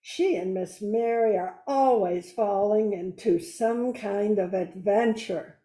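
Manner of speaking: usual speaking voice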